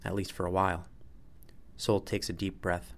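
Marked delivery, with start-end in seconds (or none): none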